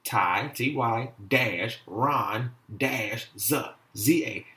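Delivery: "Ty", dash, "Ron", dash, "Z", each set apart; syllable by syllable. Shown as a demonstration. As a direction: spell out "Ty-Ron-Z" slowly in three pieces. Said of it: "You have to break this".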